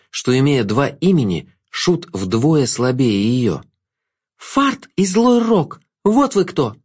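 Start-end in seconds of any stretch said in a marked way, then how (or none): 4.4-6.8 high, slow accusation; accusing girl, pitched high; through gritted teeth. A narrator's reading. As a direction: draw out each name through the teeth. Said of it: "сквозь зубы процедила она".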